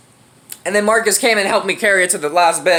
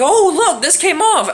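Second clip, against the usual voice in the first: higher, excited mock exclamation; excited quote; 8 semitones higher than usual. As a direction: imitate a friend's excited discovery. Retold friend showing broken brake.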